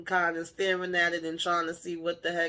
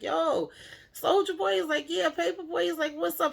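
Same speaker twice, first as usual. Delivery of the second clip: phone greeting; 11 semitones higher than usual. Retold or imitated. Rappers greeting each other on a phone call, high hype voice. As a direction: shout it up high like a hyped rapper answering.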